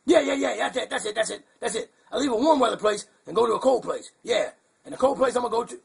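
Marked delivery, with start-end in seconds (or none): none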